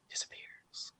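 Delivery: breathy whisper of disappears. Real, not acted. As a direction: whisper it mysteriously. Whispered ending.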